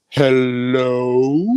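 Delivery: pointed hello. Retold or imitated. Stranger pointedly greets in elevator.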